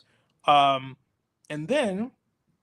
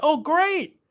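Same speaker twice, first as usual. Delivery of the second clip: bright, lifted "Oh, great"; excited; 10 semitones higher, 5 dB louder than usual. Retold or imitated. Hearing the drawings were found, thrilled and higher. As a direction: jump up with delight.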